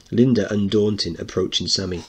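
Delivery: ordinary talk, nothing marked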